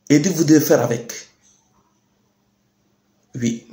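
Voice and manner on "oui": usual speaking voice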